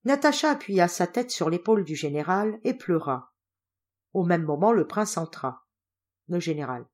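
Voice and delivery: ordinary delivery, nothing marked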